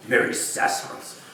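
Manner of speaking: muttered mockingly, under breath; old miser mocking the greeting; scornful mutter. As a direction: sneer the phrase to yourself. Scrooge repeats scornfully.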